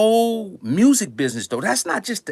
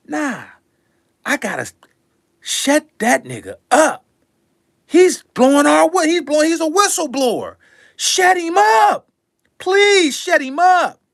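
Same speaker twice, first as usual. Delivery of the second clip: mock panicked exec; 8 semitones higher, 4 dB louder than usual. Record executives panicking about a whistleblower, high loud mock plea. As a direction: play the boss begging someone to silence him.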